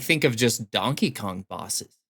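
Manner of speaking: ordinary talk, nothing marked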